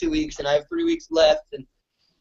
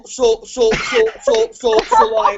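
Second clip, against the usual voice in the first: hammed-up Minnesota accent, higher and stretched so-so-so; exaggerated Minnesota accent impression of a friend; [name] Minnesota accent; 6 semitones higher, 4 dB louder, slower than usual. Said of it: "a Minnesota accent".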